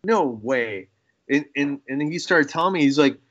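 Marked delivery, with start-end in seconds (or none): 0.0-0.9 stunned surprise. Retold or imitated. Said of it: "I was like".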